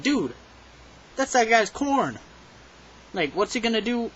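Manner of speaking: high, loud disbelief at the cashier; incredulous outrage. Retold or imitated. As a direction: call out the absurdity, stunned. Outraged as the corn spills.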